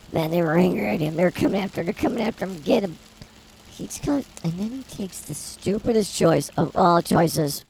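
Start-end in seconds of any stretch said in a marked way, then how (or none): none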